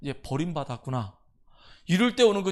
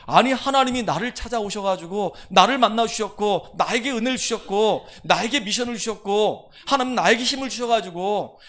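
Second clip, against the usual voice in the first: loud, urgent preacher voicing Moses' plea; impassioned preaching; 5 semitones higher, 8 dB louder than usual. Moses pleading with God for His presence, loud and fervent. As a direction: press the plea with rising fervor.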